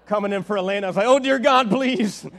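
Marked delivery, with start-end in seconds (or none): 1.1-2.3 loud, high hammed-up plea; mock panic. Joking about passengers on the landing plane, loud mock plea. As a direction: pretend terror on landing.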